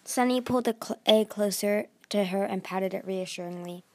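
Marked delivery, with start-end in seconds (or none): none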